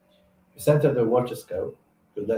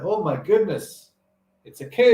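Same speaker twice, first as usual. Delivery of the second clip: excited cry; 9 semitones higher, 5 dB louder than usual. Acted. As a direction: cry out in surprised delight. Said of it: "The thing is best in a New York accent".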